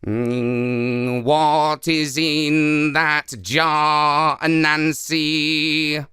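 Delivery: very slow, droning buzzy voice; slow buzzing insect drone; buzzing hornets. Acted. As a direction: hum the words like a swarm. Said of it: "buzzing and saying".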